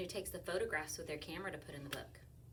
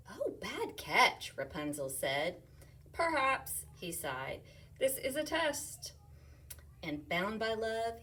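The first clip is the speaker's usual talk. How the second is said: lively read-aloud, louder as it builds; reading to children; 4 semitones higher, 7 dB louder, slower than usual. Acted. Teacher reading a rhyming picture book aloud. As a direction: bounce through the rhymes for kids.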